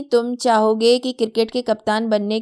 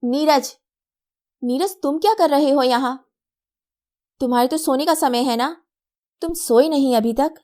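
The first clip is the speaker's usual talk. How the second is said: mother calling out from the next room, a little higher; mother calls her son from another room; 4 semitones higher than usual. A narrator's reading. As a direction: raise the voice to reach the next room. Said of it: "Anjali दूसरे कमरे से".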